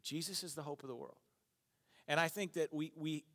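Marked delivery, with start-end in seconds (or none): 0.0-1.2 quiet conviction. Real, not acted. Quieter restatement.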